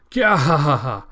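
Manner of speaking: evil villain laugh. Acted. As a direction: laugh like a gloating monster. Makaku gloating over speed.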